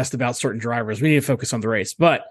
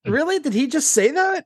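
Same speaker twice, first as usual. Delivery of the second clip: much higher, incredulous "Really?"; mock disbelief. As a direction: squeak it out in mock shock. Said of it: "Even Jenson Button was all like".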